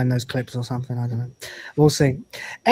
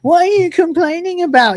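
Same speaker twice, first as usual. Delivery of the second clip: high-pitched, louder quoted whine of a question; imitated complainer; 13 semitones higher, 5 dB louder than usual. Streamer retelling a guy in the DMs, imitated high complaint. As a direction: act out the guy griping, pitched way up. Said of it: "trying to get in his D Ms and going".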